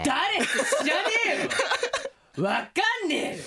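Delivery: laughing protest. Real, not acted. Protesting an unanswerable quiz question.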